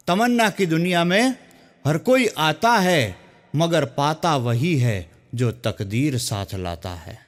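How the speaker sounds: slow, drawn-out poetic recital; shayari recital. Shown as a demonstration. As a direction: savour each line like a poet on stage. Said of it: "એક સુંદર મજાની શાયરીથી". (ધીમે, અટકી અટકીને શાયરીની રજૂઆત)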